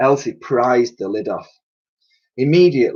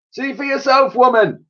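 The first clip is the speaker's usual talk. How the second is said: raised voice, pitched right up; calling back; 13 semitones higher than usual. A narrator's reading. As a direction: call back sharply from afar. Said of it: "he called back".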